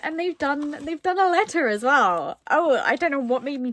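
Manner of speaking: high, loud and thrilled; excited. Real, not acted. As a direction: gush about the find. Excited about the letter.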